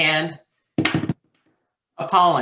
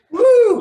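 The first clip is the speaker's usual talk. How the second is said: a loud, very high whoop; whooping cheer; 12 dB louder than usual. Real, not acted. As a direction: let out a big excited woo. Cheering after a joke.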